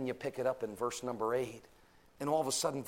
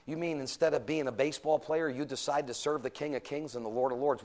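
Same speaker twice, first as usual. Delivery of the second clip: ordinary talk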